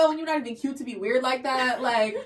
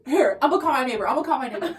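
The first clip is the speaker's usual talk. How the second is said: raised, louder imitation of a flustered friend; frantic resolve; 4 semitones higher, 6 dB louder, faster than usual. Friend deciding to call the neighbor, frantic. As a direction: blurt the plan in mock panic.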